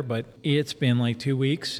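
ordinary talk, nothing marked

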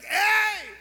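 a big high shout from the wall; shouted hey. Retold or imitated. Lepers shouting from the wall to the guards, loud call. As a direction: call it out at full lung to the city. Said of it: "le gritan".

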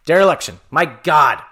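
exasperated cry at a word that won't come out; frustrated outburst. Real, not acted. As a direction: blurt it out, fed up with the word. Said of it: "I'm so tired".

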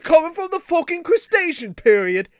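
high, loud mock-English rant; hammed-up British indignation; bad British accent. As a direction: shout it in cartoonish British. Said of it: "an American's attempt at a British accent".

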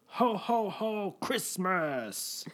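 put-on high, slow kid's Santa call; imitating a toddler's Santa; jolly ho ho ho. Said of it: "And he goes".

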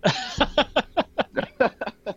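bursting high-pitched laugh. Real, not acted. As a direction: laugh out loud at yourself. Laughs at own sociopath joke.

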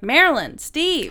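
calling parents by their first names. Retold or imitated. Grown child calling parents in public, calling out.

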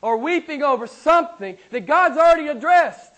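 high, loud, punched sermon delivery; full-force preaching. Real, not acted. Preacher urging believers that God is here, full-force preaching. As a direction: preach it hard, driving the key words.

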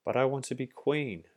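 high, loud insistent cry. A narrator's reading. Grub cries out to the bee nurse, loud and high. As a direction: demand it at the top of her voice. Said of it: "cried the grub".

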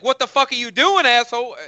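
indignant, high-pitched yell at the TV; outraged fan hollering at the screen; retold yell. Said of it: "And I yelled".

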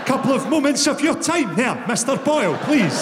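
clipped, over-polite English voice, slightly mad. Retold or imitated. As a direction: sound like a prim English businessman, a bit unhinged. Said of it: "a clipped voice means that you're a total fucking mental case".

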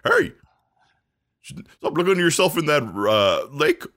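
high barky dog voice. Acted. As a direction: talk like a cartoon dog. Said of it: "My dog voice".

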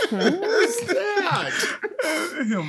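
high-pitched laughing while talking. Real, not acted. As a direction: giggle through the words. Giggling at innuendo question.

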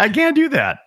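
high put-on voice for his own reply; mock protest. Acting out own reluctant reply.

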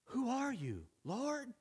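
high, softened plea; wondering question. Acted. Saul asks the unknown voice its name, high and wondering. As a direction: ask it high, each phrase falling away.

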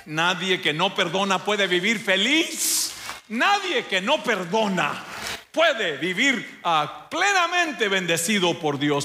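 high, fervent preaching voice; preaching at full force. Acted. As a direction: proclaim it to the congregation. Preaching climax.

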